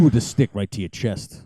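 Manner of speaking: ordinary talk, nothing marked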